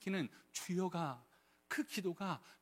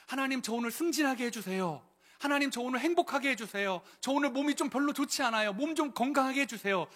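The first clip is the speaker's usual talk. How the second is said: imagined selfish prayer; 6 semitones higher, 4 dB louder than usual. Pretend. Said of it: "다니엘의 기도는 그러면 어떤 기도였을까요?".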